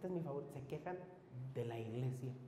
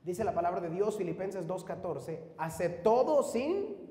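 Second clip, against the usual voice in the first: rising preaching call; 8 dB louder than usual. Real, not acted. Preacher raises voice on scripture.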